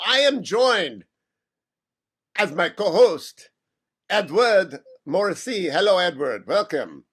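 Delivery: put-on French accent, hammy radio host; playful fake French accent. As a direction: greet listeners in a mock French accent. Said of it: "use a French accent".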